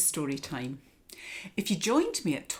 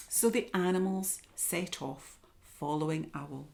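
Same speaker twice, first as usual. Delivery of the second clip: ordinary delivery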